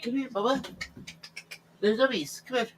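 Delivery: high-pitched coaxing call; high pet call. Real, not acted. Calling his cat out from under the bed, high coaxing. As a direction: lure the cat out sweetly.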